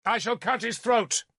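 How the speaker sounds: high-pitched threatening call. A narrator's reading. Galahad warning Nimue with a blade at Derval's throat, calling out. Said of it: "he called to Nimue".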